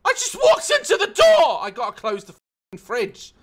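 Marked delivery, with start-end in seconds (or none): 0.1-1.6 higher, louder exasperated shout. Real, not acted. Gamer shouting after walking into a door, outraged shout. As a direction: yell it in outrage.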